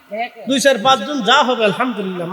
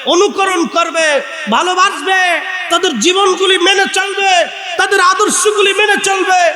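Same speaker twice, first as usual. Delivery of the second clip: high, loud, ringing sermon delivery; full-force preaching; 7 semitones higher, 4 dB louder than usual. Real, not acted. Preacher urging the crowd to follow the Prophet's family, impassioned preaching. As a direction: drive each clause up to a held, shouted ending.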